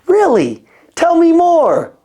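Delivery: hammed-up enthusiastic listening; eager listener. Shown as a demonstration. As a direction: show you're hanging on every word. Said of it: "I gotta be like this".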